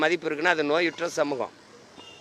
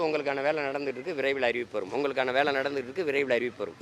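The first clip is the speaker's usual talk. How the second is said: quick, softer imitation of a routine promise; mimicked stock reassurance; 3 semitones lower, 7 dB quieter, faster than usual. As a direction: reel it off low-key, like a repeated official line. Mimics officials' repeated stock promise.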